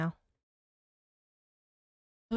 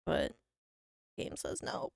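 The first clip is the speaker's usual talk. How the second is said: normal talking voice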